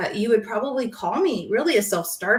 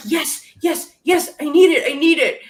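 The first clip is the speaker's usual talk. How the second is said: loud, raised, eager retold plea; eager acceptance; 5 semitones higher, 5 dB louder than usual. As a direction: burst out as if begging for more. Retelling eager welcome of tough love.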